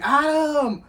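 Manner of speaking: high, loud call of a name. Retold or imitated. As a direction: sister yelling the name down the street. Sister calling her name.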